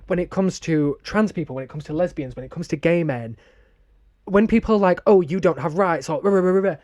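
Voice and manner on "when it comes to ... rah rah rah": normal talking voice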